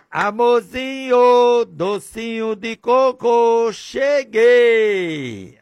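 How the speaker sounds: high, slow, honeyed pet names; sweet playful greeting. Acted. Husband comes home and calls his wife pet names, high and sugary. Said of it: "Olha a forma totalmente diferente".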